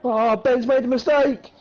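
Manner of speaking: high, drawn-out race call; excited exclamation. Real, not acted. Commentator seeing leader Ben's mistake, excited. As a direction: stretch it out high as the error happens.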